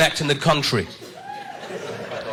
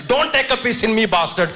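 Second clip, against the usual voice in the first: put-on higher Indian-accented insult; Indian heckler; 8 semitones higher than usual. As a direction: jab the mixed-up insult out. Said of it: "get their insults the wrong way around".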